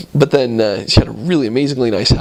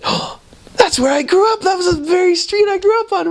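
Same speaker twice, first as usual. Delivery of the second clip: much higher, delighted exclaim; excited amazed woman. Retold or imitated. The contact tells the missionaries it was her street, much higher and delighted. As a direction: gush about the coincidence.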